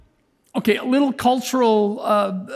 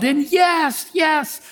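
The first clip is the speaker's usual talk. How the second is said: emphatic yes; 8 semitones higher, slower than usual. Real, not acted. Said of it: "emphatic yes".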